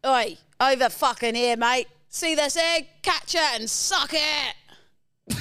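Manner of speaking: high, loud British heckling; working-class British yell. Acted. Brit with his mates heckling King Charles, loud British yell. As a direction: bellow it like an angry British lout, building to a drawn-out finish. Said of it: "you're a working class Brit".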